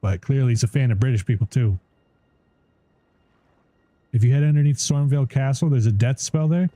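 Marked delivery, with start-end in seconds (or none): none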